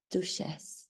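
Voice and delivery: softly Frenchified Duchess, said 'doo-shess'; low, soft French-accented Duchess with a 'sh'. Shown as a demonstration. Showing how she now pronounces her title, French accent. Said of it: "She has more of a French accent".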